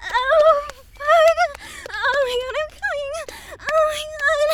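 climax cry. Acted. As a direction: let it burst out at the peak.

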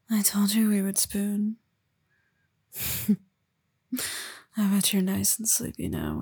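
ordinary delivery

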